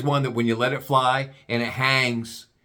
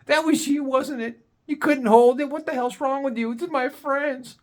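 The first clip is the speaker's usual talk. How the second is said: high, scolding female voice; the embarrassed date chews him out; 12 semitones higher than usual. Retold or imitated. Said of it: "She goes".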